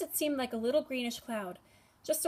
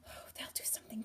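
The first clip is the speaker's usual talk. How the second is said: breathy hushed whisper; 12 dB quieter, faster than usual. A narrator's reading. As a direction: whisper it, afraid of being overheard. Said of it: "whispered Bartholomew".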